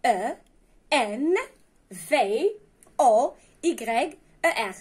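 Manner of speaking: letters spelled out one by one, slowly; slow spelling. Shown as a demonstration. Teacher spelling a verb slowly to pupils. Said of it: "شوفوا معايا".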